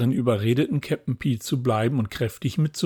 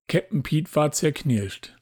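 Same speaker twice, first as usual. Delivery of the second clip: plain reading voice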